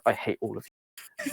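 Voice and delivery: low, quiet grumble; under his breath. Acted. Computer to the players as tape rewinds, under his breath. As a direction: drop it low and soft, barely meant to be heard. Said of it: "saying under his breath".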